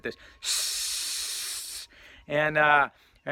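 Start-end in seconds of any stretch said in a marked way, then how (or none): 0.4-1.9 long sustained hiss; oxygen hiss. Retold or imitated. Mimicking the oxygen coming out of the mask, steady hiss. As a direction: imitate gas escaping from a valve. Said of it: "hiss that comes out of it".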